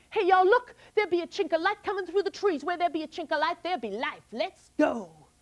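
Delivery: put-on high, eager young girl voice; excited little girl leading her sisters; plucky girl Molly. Molly sees the light.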